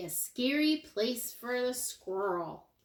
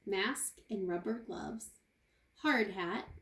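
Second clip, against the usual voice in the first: usual speaking voice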